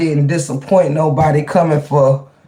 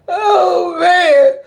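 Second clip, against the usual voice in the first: laughing outburst; 12 semitones higher than usual. Real, not acted. Bursting out after confession.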